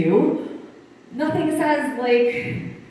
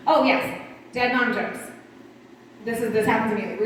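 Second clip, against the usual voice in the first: usual speaking voice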